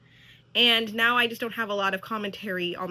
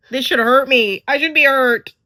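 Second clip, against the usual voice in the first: much louder, emphatic protest; loud exasperation; 13 dB louder than usual. Real, not acted. Viewer protesting that the drama should hurt, exasperated. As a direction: insist loudly, exasperated at the show.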